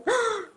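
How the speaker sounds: high sharp mock gasp of shock. Teacher reacts in mock horror to students saying India. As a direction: gasp as if the mistake were scandalous.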